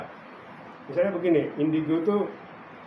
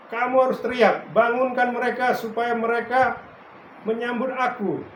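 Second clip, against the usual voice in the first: commanding call; 6 semitones higher, 5 dB louder than usual. Retold or imitated. God's command retold in prayer.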